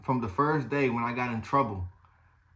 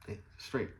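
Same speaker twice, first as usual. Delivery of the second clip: half-whispered shrug; 4 semitones lower, 11 dB quieter than usual. Retold or imitated. Shrugging reply to advice.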